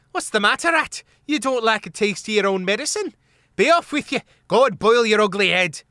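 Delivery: high loud taunting; jeering Hogmaid. A narrator's reading. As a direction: tell him off loudly. Trimp jeering at rat.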